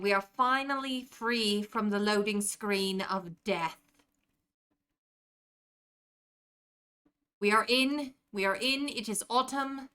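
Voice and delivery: a put-on British accent held through casual stream chat. Streamer doing a five-minute British accent for a chat redeem, British accent. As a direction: keep the British accent going, trying not to drift. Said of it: "holding this accent better than I typically do".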